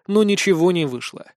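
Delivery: usual speaking voice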